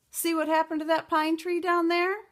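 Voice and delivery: high and loud; bold bluff. Acted. Beatrice boasts to the giant, high and loud. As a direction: show off the broken tree.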